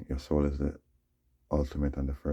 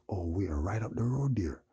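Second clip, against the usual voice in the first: whispered quote; 5 semitones higher than usual. Retold or imitated. Quoting friend about building.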